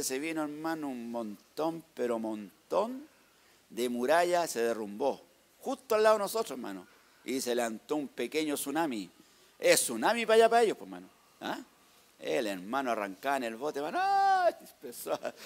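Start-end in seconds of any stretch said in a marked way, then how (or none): 0.9-3.1 "montón" dragged out slowly, both times; slow, lingering weight on "montón"; emphatic stretching. Real, not acted. Stresses the huge amount that fell. 14.0-14.5 very high, loud mock scream; hammed-up high cry of fright; imitated scream. Acts fright as boat flees wave.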